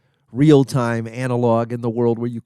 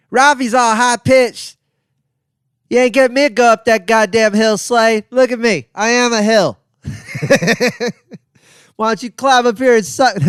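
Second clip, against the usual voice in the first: Ralphie impression; 9 semitones higher, 5 dB louder than usual. Impersonating his friend Ralphie May for a fan, high-pitched. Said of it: "Ralphie has a higher pitched voice".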